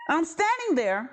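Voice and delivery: usual speaking voice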